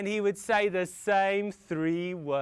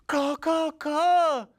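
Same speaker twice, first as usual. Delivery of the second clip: high, loud string of caws; puzzled crow; 7 semitones higher, 7 dB louder, slower than usual. Acted. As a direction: caw back in confusion. Puzzled father crow replies.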